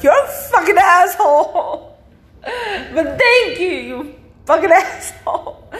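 high, laughing, whiny indignation; hammed-up wail through laughter, pitched well up; mock-tearful outburst. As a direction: curse your friend in a high, half-crying mock rage. Acts her tearful reply to friend.

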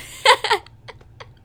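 high, loud burst of laughter; cracking up at the story. Real, not acted. Laughing at sister's joke.

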